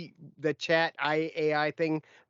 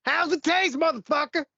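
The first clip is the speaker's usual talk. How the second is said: Samuel L. Jackson; 10 semitones higher than usual. Retold or imitated. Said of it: "That's a Samuel Jackson".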